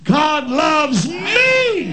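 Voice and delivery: "me" shouted high and held long; slow build to a big shouted "me"; stress on me. Shown as a demonstration. Preacher leading the church through the pattern, stress on "me". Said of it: "each time emphasizing a different word".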